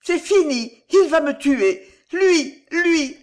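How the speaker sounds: panicked inner outcry, high and loud; panicked cry. A narrator's reading. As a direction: scream it in sheer panic. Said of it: "affolé, clama dans son esprit".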